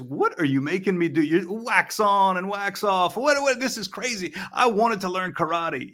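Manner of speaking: student griping to his karate master in a mild put-on voice; Daniel complaining. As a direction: imitate a pupil whining to his teacher. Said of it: "Daniel goes to Mister Miyagi and he says".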